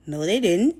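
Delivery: loud, insistent retort; emphatic denial. Retold or imitated. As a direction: contradict him forcefully. Contradicts boyfriend hearing voices.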